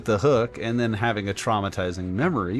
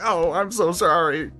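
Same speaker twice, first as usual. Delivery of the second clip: higher, apologetic little voice; mock apology; 5 semitones higher, 3 dB louder than usual. Apologizing to the worm while hooking it, in a raised, sorry little voice. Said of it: "I was just like".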